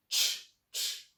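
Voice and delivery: ch as in "chat", breathy and unvoiced. Shown as a demonstration. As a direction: puff out ch, ch with no voice. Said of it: "like chat".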